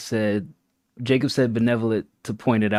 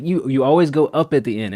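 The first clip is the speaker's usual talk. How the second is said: normal talking voice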